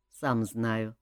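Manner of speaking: low, soft grumble; grumbling. A narrator's reading. King told not to tire his shooting hand, grumbling. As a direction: concede it grudgingly under your voice. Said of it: "проворчал король".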